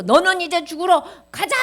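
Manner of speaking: loud, high executioner's order; harsh command. Acted. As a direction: order the condemned away loudly. Guard drags Haman off to die. (사형수를 끌고 가는 큰 호령)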